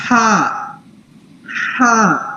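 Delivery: ہا with a warm-hands breath hiss from the throat; breathy throat ḥa. Shown as a demonstration. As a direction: blow ہا out like warming your hands. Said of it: "ہا کی sound". (ہا سردی میں ہاتھ گرم کرنے والی سانس کی طرح)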